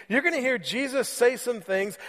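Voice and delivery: usual speaking voice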